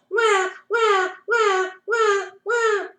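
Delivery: put-on high screaming baby wail; high-pitched mock baby scream. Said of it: "screamed really loudly".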